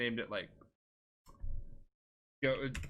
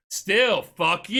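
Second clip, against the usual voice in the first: loud, higher hammed-up defiance; mock defiance; 4 semitones higher, 6 dB louder, much slower than usual. Player retorting to an enemy warrior, loud mock defiance. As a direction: throw it out like a playful battle taunt.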